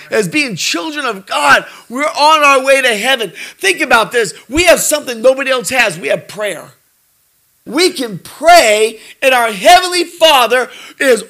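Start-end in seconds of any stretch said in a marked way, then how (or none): none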